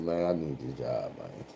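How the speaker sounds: low, soft resigned sigh. Retold or imitated. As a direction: mutter it to yourself, defeated. Resigned inner thought.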